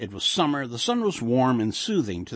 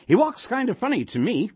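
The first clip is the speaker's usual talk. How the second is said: higher, lightly voiced old wife; sing-song Yiddish-inflected old woman; 10 semitones higher, 4 dB louder than usual. A narrator's reading. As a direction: lift the pitch for a doubtful old wife. Old wife remarks to husband.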